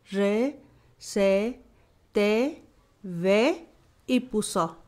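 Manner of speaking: each Guaraní consonant sound said loud and clear, syllable by syllable; consonant drill. Shown as a demonstration. Teacher to students learning Guaraní, slow clear consonant drill. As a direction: drill every consonant syllable crisply for the class. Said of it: "presten especial atención al sonido de las consonantes".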